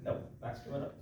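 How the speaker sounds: quiet retold mutter. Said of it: "thought it'd be a really good idea to sit up and go".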